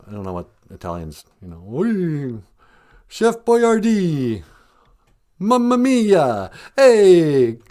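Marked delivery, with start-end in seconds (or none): 1.6-7.6 hammed-up Italian accent, higher and slow; mock Italian accent. Comic impression of Columbus pitching the Queen in a mock Italian accent. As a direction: ham up a fake Italian accent. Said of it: "So he had an accent like".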